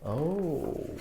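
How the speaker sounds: usual speaking voice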